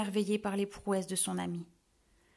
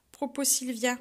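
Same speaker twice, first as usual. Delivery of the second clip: usual speaking voice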